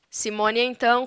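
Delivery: ordinary delivery, nothing marked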